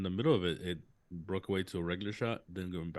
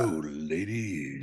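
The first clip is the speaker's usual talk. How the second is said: smooth flirty voice; 2 semitones lower, 4 dB louder, slower than usual. Pretend. Joking about sexy voice.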